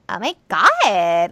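put-on loud, drawn-out Valley girl exclamation; big slow Valley-girl squeal; eighties Valley girl. Shown as a demonstration. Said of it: "Valley Girl to me is more like".

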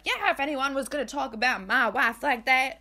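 indignant husband in a mock Southern accent. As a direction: quote him in a hammy Southern twang. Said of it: "I don't know why he's Southern".

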